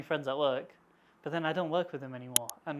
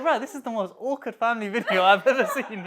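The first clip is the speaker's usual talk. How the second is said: louder, higher, laughing; laughing quote; 6 semitones higher, 8 dB louder than usual. Retold or imitated. Joking about the awkward family video, laughing loudly. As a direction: laugh the line out in disbelief. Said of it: "I was like".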